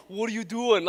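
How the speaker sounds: mock an angry Boston voice asking what you're doing; mock Boston accent. Pastor mocking an angry God with a Boston accent. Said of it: "what is he, from Boston?".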